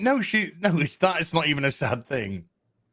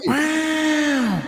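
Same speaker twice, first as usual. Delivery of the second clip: long high-pitched wow; drawn-out scandalised wail; exaggerated shock; 12 semitones higher, 4 dB louder than usual. Real, not acted. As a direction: stretch it out high in disbelief. Mock-shocked reaction to harsh joke.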